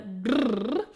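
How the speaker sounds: rolled brrr through loose lips; lip trill. Shown as a demonstration. Voice coach demonstrates the first warm-up, lip trill. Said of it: "so a lip trill".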